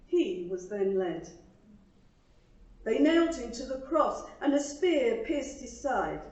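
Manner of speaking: earnest, measured poem reading with pauses; poem recital. Acted. Reading her poem to the congregation, earnest recital. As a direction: recite it like a heartfelt church poem.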